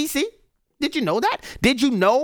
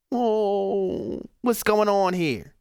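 slow, mock-fretful "what's going on here"; mock lament; 3 dB quieter, much slower than usual. Host mocking Biden's lament, slow fretful voice. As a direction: imitate a hand-wringing lament, slowly. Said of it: "Joe Biden and others lamenting".

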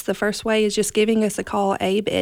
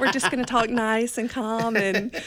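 laughing; 3 semitones higher than usual. Real, not acted. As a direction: giggle through the words. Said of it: "have a Southern voice".

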